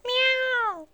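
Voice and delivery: very high cat meow. Acted. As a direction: mew like a cat. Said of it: "powiedziała kotka".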